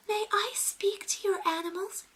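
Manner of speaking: high, sweet princess voice. Retold or imitated.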